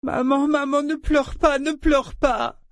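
put-on very high little-boy wail; sobbing child. A narrator's reading. Small son to his crying mother, sobbing. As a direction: plead tearfully in a child's high voice. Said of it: "Il ajouta ses sanglots à la plainte de sa mère".

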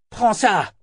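high shouted command. A narrator's reading. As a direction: yell it as you throw. Throwing a weapon in battle.

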